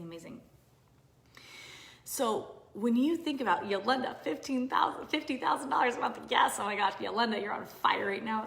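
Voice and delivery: ordinary talk, nothing marked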